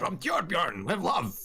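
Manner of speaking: hammed-up character voice, pitched high; Torbjorn. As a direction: sign off a letter in a big put-on voice. Voicing the letter writer Torbjorn.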